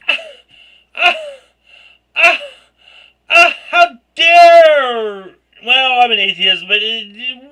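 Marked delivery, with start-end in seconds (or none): 0.1-5.3 loud, high, slow mock indignation; mock outrage. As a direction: stretch it out like a hammed-up diva. Exaggerated outrage.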